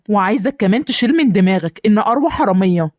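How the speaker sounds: ordinary talk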